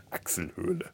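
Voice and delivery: softly repeated with a rising lilt; amused quiet repeat. Retold or imitated. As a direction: echo the word under your breath, amused. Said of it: "laughs at the word".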